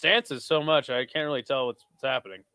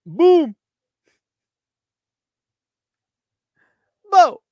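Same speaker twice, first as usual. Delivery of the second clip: higher and louder, the punch's impact yelled as a boom; excited boom; 13 semitones higher, 9 dB louder than usual. Real, not acted. Streamer reliving the knockout punch, in an excited boom. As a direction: shout the hit like a sound effect.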